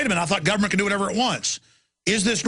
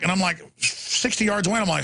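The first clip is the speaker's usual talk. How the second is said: normal talking voice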